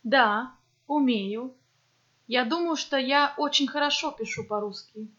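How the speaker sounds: ordinary delivery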